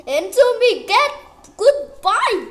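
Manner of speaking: loud taunting goodbye; yelling. Real, not acted. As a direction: shout goodbye at the rival. Yelling at a chasing player.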